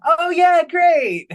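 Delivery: high, loud, hammed-up agreement; big put-on cheer, high and loud; mock enthusiasm. Acting Tendi's reply to brunch.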